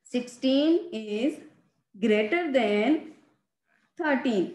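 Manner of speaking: slow, careful read-out of the comparison; slow reading aloud. Shown as a demonstration. Teacher reading the sign to students, slow and careful. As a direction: spell it out slowly for the class. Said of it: "हम इसे ऐसे पढ़ेंगे".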